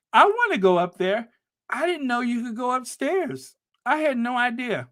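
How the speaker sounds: high, loud excited realization. Retold or imitated. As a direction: gasp it out in wonder. Retelling excited discovery at Notre Dame.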